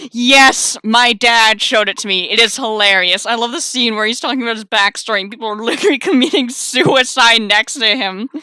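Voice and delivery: loud, laughing gush; loud enthusiasm. Real, not acted. Player gushing about a favourite film scene, loudly excited.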